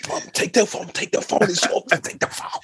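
hushed, pleading whisper off the phone; urgent whisper. Retold or imitated. Kid whispering to mum to take the phone, urgent whisper. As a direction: beg mum to take over without the caller hearing.

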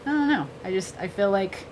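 ordinary talk, nothing marked

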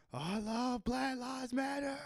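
high, slow put-on voice, mocking; mock sincere slogan. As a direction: say it in a high, slow, falsely earnest voice. Mocking a racist's fake slogan.

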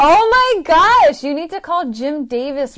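high, loud, slow exclaim; gasping with excitement; excited. Real, not acted.

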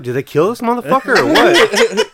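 laughs through the words, pitch up high; laughing. Real, not acted. Waiting outside for his buddy, laughing. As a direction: spill it out mid-laugh. Laughing while telling the story.

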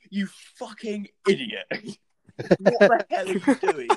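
high, loud outraged shout; cracking into laughter mid-rant; mock furious yell. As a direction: tear into him like a livid brother. Brother yelling abuse.

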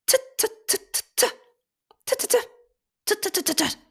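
tiny bird pecking, very high "tit, tit"; high tapping bird sound. A narrator's reading. Bird pecking near the drowsy little elephant, very high tapping. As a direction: peck it out in a squeaky high voice. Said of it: "machte es wieder".

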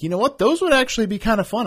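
high, bright burst of enthusiasm; light up with the idea; excited. Retold or imitated. Excited retold thought.

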